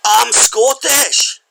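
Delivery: put-on loud, high, drawn-out Scottish accent. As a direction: belt out a hammed-up Scot on the phone. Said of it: "I'll be Scottish for this one".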